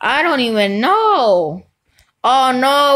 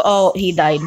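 normal talking voice

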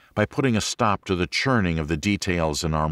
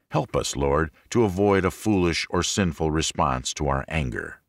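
normal talking voice

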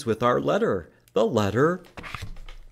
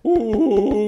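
gorilla hoot; 10 semitones higher, 6 dB louder than usual. Shown as a demonstration. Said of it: "pound your chest like a gorilla".

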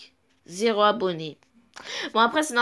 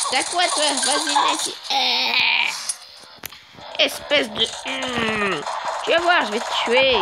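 crazy voice; 2 semitones higher than usual. Pretend. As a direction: play a gleeful lunatic threatening someone. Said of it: "je vais aussi essayer de prendre une voix de fou".

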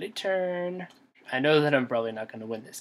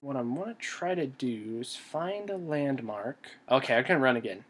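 usual speaking voice

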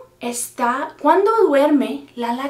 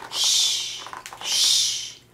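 drawn-out "shh"; hushing; 3 dB quieter than usual. Acted. Grandma hushes for the sleeping kitten, shushing. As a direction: hush them, twice.

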